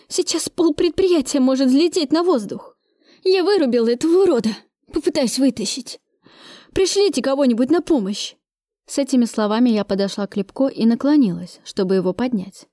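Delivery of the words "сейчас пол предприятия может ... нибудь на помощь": high-pitched plea for help; urgent alarm. A narrator's reading. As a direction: call in an emergency, voice pitched up. Urgent radio call for help. (тревожный призыв о помощи высоким голосом)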